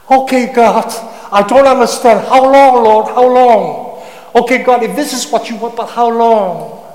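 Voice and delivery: loud, high pleading to God; a psalmist crying loudly how long. Acted. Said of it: "One of the cry of the psalmist".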